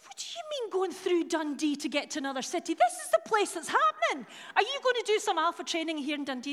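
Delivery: loud, high-pitched telling-off; hammed-up indignant demand; mock indignation. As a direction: challenge them with playful outrage. Retelling indignant phone call to colleague.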